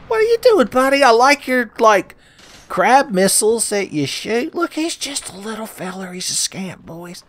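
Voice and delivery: put-on high, loud cooing little boy voice; wholesome 1950s kid. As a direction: chirp it like a wide-eyed kid. Cooing at little crab enemy.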